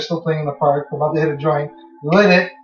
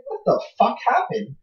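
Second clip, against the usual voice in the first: shocked; 6 semitones higher, 6 dB quieter than usual. Retold or imitated. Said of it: "I'm like".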